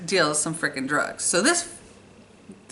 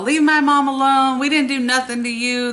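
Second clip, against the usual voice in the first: pleading shout; 6 semitones higher, 4 dB louder than usual. Retold or imitated. Granddaughter pleading with attacker.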